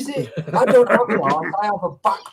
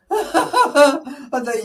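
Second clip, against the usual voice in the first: laughing through the words; 8 semitones higher, 6 dB louder, much slower than usual. Real, not acted. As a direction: giggle while saying it. Laughing while retelling.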